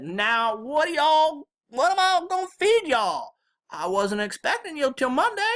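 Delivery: very high, loud granny voice; flustered Southern grandma. A narrator's reading. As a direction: fret loudly as a Southern granny hostess. Flustered grandma about feeding guests.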